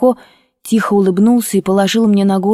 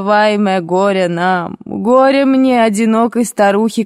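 wailing lament. A narrator's reading. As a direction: lament like a village mourner, stretching the words. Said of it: "бабушка заплакала и запричитала, как простая грузинка". (протяжное причитание)

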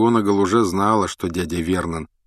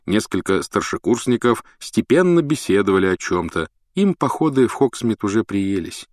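ordinary delivery

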